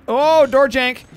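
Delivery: loud, high exclamation; excited outburst. Real, not acted. Caster spotting a door jank, excited. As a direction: burst out as the trick lands. Caster reacts to sudden trick.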